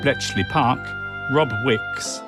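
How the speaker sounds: normal talking voice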